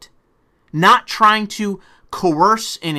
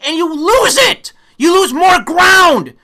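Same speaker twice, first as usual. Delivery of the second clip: slow furious yell, much higher and louder; angry shout; 9 semitones higher, 6 dB louder, slower than usual. Real, not acted. Warning Democrats about losing votes, shouting angrily. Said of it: "I'm getting even more angry".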